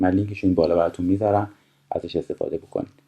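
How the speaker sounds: normal talking voice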